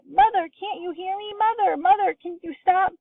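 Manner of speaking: very high, pleading calls; desperate wail. A narrator's reading. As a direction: daughter begging her shrinking mother. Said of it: "wailed Missus Bucket".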